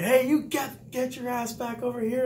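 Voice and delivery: high shouted command at a runaway recruit; instructors yelling. Retold or imitated. Recruit instructors yelling at a kid walking out: high mock shout.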